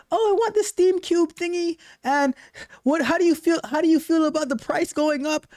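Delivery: high-pitched imitation of a complaining gamer; exaggerated crybaby imitation; mock whining. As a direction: whine it in a put-on high voice. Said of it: "you were crying about the other day".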